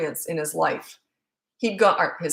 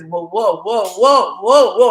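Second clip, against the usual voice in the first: imitated whoas, building steadily louder; son's mock whoas; 10 dB louder, much slower than usual. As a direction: act out a kid making a show of struggling, swelling whoa by whoa. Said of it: "he'd go like this".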